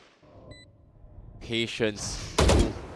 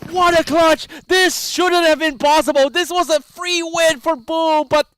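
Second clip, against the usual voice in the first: screaming play-by-play, high and loud; esports caster; 9 dB louder, slower than usual. Real, not acted. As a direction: yell the clutch at full volume. Screaming over a clutch.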